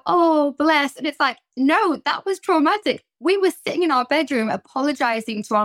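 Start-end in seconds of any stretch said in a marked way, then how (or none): 0.1-1.0 high, louder cooing "Oh, bless"; mock fond coo. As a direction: coo fondly over the old notes. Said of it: "we go".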